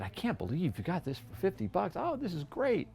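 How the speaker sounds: high, gleeful rush of disbelief; excited customer. Retold or imitated. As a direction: imitate a thrilled buyer who can't believe his luck. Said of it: "so excited to get it".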